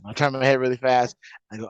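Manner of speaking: normal talking voice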